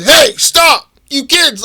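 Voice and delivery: put-on loud, high yell after fleeing kids; imitated guard's shout. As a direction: holler like a guard stopping shoplifters. Retold guard calls out to kids.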